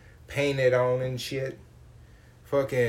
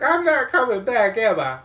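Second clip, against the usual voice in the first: mock scared; 9 semitones higher, 7 dB louder than usual. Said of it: "I was like".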